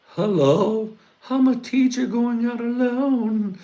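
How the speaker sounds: very high put-on lady voice; fake teacher voice. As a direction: squeak it out like a fake woman teacher. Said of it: "I'm gonna pretend to be a teacher".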